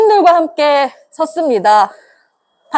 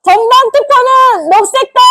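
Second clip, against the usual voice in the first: rally shout; 6 semitones higher than usual. Real, not acted. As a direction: shout the slogan out. Leads rally chant.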